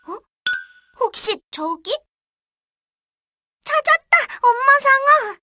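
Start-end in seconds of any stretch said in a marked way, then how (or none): 3.6-5.4 high joyful cry; excited shout. Acted. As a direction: shout with delight at finding her. Finding mother, joyful shout.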